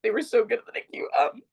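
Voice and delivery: real tearful voice rising high; high, overcome with emotion; choked up. Real, not acted. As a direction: break into tears and apologize. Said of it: "I knew this was gonna happen".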